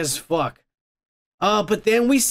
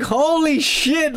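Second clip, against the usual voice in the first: high, drawn-out holy shit, much higher; shocked exclamation; 9 semitones higher, slower than usual. Retold or imitated. Reacting to the cartoon's cheating twist, stunned and high. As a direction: gasp it out in pure shock, slowly. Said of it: "And I'm all like".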